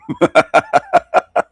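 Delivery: high villainous chuckle; gloating laugh. Real, not acted. Pleased with the Joker comparison, a gloating chuckle. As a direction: cackle at your own joke.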